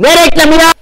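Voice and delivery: high, loud irritated yell; annoyed shout. Real, not acted. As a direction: snap at the sudden ad. Annoyed by an ad popping up.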